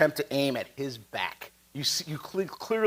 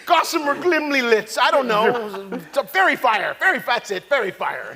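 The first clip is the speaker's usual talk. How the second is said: high, loud, comic fumbling; groping for a password; 7 semitones higher, 4 dB louder than usual. Acted. Villain fumbles for the forgotten password, high comic guessing. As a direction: guess at it, hammed-up, as if half-remembering. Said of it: "Let's see if he remembers that".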